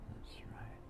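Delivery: soft breathy whisper. Real, not acted. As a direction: whisper it encouragingly. Whispered encouragement mid-exercise.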